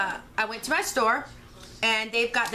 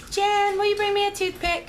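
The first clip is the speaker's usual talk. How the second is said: husband calling; 10 semitones higher, 3 dB louder than usual. Retold or imitated. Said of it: "So he calls me".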